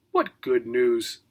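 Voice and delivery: put-on low, slow, soft old dog voice; sleepy deaf old dog perking up; groggy old dog. A narrator's reading.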